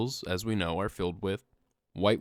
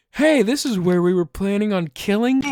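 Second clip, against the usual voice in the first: louder, higher blurted announcement; joking blurt to friends while being strapped in; 9 semitones higher, 7 dB louder than usual. Retold or imitated. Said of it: "I just go out loud and I say".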